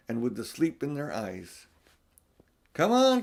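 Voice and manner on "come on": high, loud cry of delight; nearly dancing with joy as you call. Acted. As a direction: beaver cries out to the children, overjoyed. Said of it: "cried Mister Beaver, who was almost dancing with delight".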